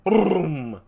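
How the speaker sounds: long rolling vocal boom; imitated cannon boom. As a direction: voice a cannon going off. Imitates the guns in stage direction.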